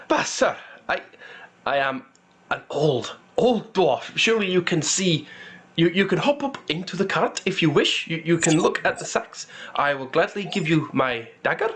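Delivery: put-on shy old dwarf. Old dwarf offers the guard a look in his cart, shy and hesitant. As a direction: plead gently with a shy smile. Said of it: "smiles shyly and says".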